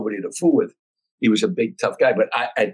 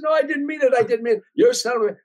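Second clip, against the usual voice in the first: much higher, flustered denial; imitating panicked Biff; 13 semitones higher than usual. Biff denies hitting Jay to the crowd, high panicked voice. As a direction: play the tough guy suddenly squeaking in panic. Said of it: "he said".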